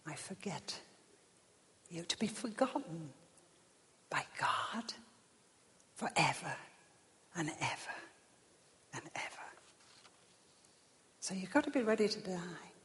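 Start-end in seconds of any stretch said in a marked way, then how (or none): 0.1-2.0 very soft, halting whisper; whispered verdict. Acted. God telling a soul it is forgotten, whispered. 2.0-9.3 very soft, near-whisper, spaced by long pauses; hushed dread. Real, not acted. Preacher warning of being forgotten by God, hushed.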